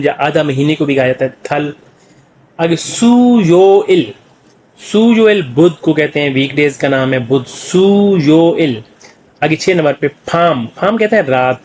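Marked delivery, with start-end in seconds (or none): 2.8-4.1 सुयोइल stretched very slowly as soo, yo, il; drawn-out syllables. Shown as a demonstration. Teacher introduces Wednesday in Korean, syllables drawn out. 7.5-8.8 सुयोइल very slow, soo, yo, il; drawn-out syllables. Shown as a demonstration. Teacher repeats Wednesday for learners, syllables drawn out.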